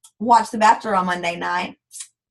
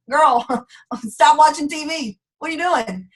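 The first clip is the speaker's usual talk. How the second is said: ordinary talk, nothing marked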